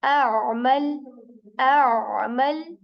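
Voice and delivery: a'mal with a choked opening a; throaty amala. Shown as a demonstration. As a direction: start a'mal with a squeezed throat sound. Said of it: "like this".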